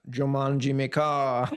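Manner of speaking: slow mock Jamaican accent. Shown as a demonstration. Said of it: "say this in a Jamaican accent".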